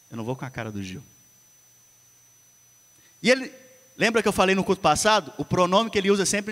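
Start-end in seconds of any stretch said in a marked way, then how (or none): 0.1-1.0 a quiet inner voice, much softer; pensamento sussurrado. Retold or imitated. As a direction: say it like a thought creeping in. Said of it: "Primeiro ele lança uma, um pensamento". (pensamento dito bem baixinho)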